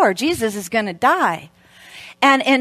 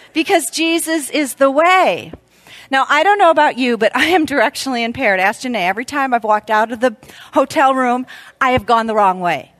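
ordinary delivery

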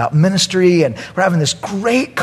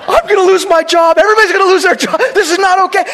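high, loud panic; mock panic, much higher and louder; panicked; 5 dB louder, faster than usual. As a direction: blurt it in rising alarm. Panicking at the naked boys.

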